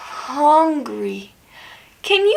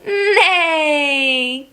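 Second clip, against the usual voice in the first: long drawn-out playful neigh; stretched-out mock whinny; horse neigh. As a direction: imitate a horse for small children. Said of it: "What does a horse say?".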